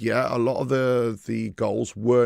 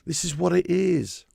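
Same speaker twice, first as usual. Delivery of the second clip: higher, resigned fan voice; 6 semitones higher than usual. Retold or imitated. Fans at Anfield shrugging off the goal, resigned. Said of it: "there's fans going".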